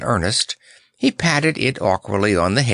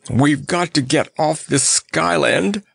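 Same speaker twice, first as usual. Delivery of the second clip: high, blustery fuss; blustering; 7 semitones higher than usual. A narrator's reading. Lion desperate to leave the island, blustering. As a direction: bluster it in a higher voice. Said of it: "blustered the poor lion".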